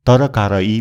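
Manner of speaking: तरकारी broken up as ta-ra-kaa-raa-ee; mispronounced Nepali word. Shown as a demonstration. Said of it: "यो तरकारी होइन". (तरकारी लाई त-र-का-रा-ई भनेर गलत उच्चारण)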